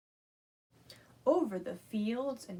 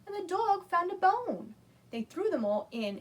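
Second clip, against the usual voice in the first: ordinary delivery, nothing marked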